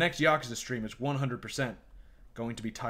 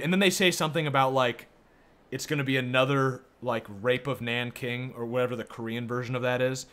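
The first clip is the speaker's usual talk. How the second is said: usual speaking voice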